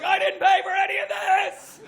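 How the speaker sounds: high, loud, giddy yelp; gleeful shout. Retold or imitated. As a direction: shriek with guilty delight at free shampoo. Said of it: "You're like".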